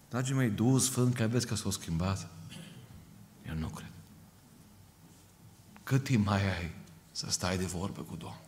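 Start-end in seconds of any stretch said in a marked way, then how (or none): 3.5-3.9 quiet denial. Real, not acted. Preacher's soft emphatic denial.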